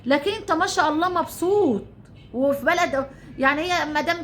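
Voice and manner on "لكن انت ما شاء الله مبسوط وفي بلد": usual speaking voice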